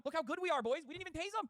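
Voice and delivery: high, loud sarcastic cop voice; mocking cops. Streamer mocking police bragging, high sarcastic voice. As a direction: sneer it out as a proud cop.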